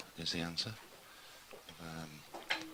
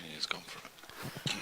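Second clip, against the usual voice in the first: snooker commentary whisper; 3 dB quieter than usual. Acted. Hushed commentary after shot.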